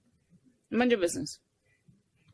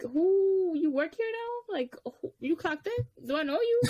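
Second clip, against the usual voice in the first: high-pitched, affronted questioning; incredulous; 7 semitones higher, slower than usual. Retold or imitated. Questioning a stranger who joined the conversation, incredulous. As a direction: stretch out the high who, acting baffled. Retelling reaction to intruding stranger.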